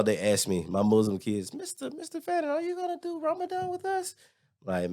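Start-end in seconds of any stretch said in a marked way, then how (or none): 1.5-4.1 put-on high, soft child's voice; kid voice. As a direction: ask like an eager little kid. Kids asking him in story.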